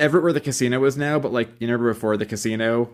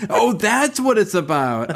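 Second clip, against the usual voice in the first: mock slow viewer; 9 semitones higher, slower than usual.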